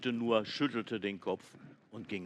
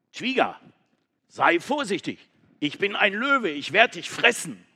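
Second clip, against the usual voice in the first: loud, higher would-be lion threat; mock threat; 8 semitones higher, 11 dB louder than usual. Goat warning the giraffe she will eat her, loud. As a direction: puff yourself up and warn her loudly.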